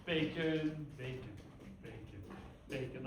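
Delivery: first word firm, the rest dropping away quietly. Shown as a demonstration. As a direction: start firm then fade each repeat. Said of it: "I don't have to do it as loud".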